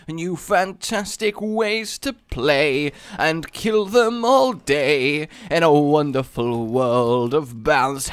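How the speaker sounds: slow, hammed-up commercial voice; dramatic infomercial narration, dragged out; cheesy ad read. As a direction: sell the bouncy tears like a TV ad. Reads item blurb like an ad.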